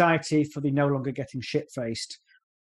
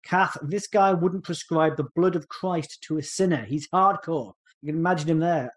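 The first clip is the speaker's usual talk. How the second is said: ordinary delivery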